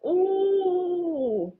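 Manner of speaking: long, high-pitched "ooh" of disgust; high groan at a foul smell. Real, not acted. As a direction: recoil from a stench with a drawn-out ooh. Recoiling at a foul bathroom smell.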